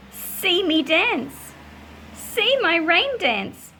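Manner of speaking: exuberant, rhythmic picture-book reading; story narration. A narrator's reading. Children dance and run in the rain, joyful storytelling. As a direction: lift the list of actions with rising delight.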